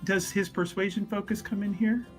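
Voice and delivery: usual speaking voice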